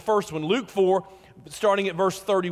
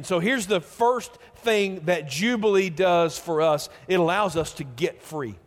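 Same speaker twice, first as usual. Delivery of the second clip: ordinary talk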